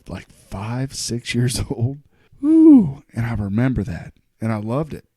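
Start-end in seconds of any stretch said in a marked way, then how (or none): 2.4-3.0 much higher, much louder whoop; excited whoop. Real, not acted. Host remembering loving Saturday Night Live, loud whoop. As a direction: let out a big joyful woo. Excited about childhood SNL memory.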